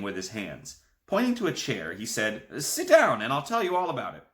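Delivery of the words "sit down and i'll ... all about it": excited. A narrator's reading. Excited grandpa.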